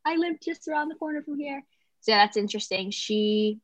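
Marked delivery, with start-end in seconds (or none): 0.0-1.6 higher, lightly put-on mother's voice; imitating her mom. Daughter quoting her mother about their old neighbourhood, higher imitating voice. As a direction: mimic mom fondly pointing out her old haunts, pitched up. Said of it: "she's always like".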